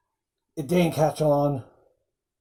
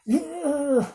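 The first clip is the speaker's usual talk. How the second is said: sudden yelp jumping much higher; mock angry yell; 11 semitones higher than usual. Governor losing his temper, a high mock angry yell. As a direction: bark it like the furious governor. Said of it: "Mel gets angry and is like".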